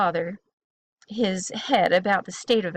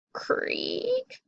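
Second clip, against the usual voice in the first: long, drawn-out creak; slow imitated door creak; creaking hinges; 13 semitones higher, 7 dB quieter than usual. A narrator's reading. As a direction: stretch the word like an old door. Voicing creaking hinges in story.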